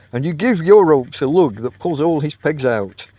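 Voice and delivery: usual speaking voice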